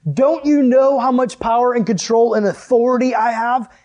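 loud, high, insistent rebuke; Jesus scolding. Acted. Jesus reminding disciples of his miracles, loud exasperated scolding. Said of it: "So Jesus kinda scolds them".

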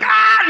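high loud startled scream. Retold or imitated. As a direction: yelp like a scared kid. Dentures coming at the kid.

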